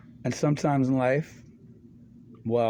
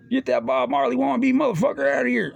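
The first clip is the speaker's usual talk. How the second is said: high, loud bouncer yell; a retold yell of a bouncer throwing him out; mock yell; 12 semitones higher, 4 dB louder than usual. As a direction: bark him out of the club.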